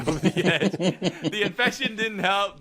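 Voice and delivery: high, slow words broken by laughter; laughing. Real, not acted. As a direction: laugh through it. Laughing through the list.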